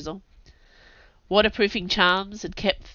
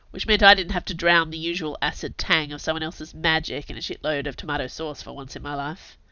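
usual speaking voice

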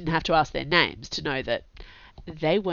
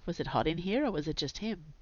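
usual speaking voice

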